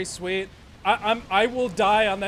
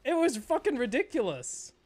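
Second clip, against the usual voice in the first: ordinary talk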